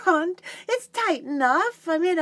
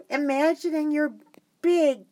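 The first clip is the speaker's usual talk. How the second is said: normal talking voice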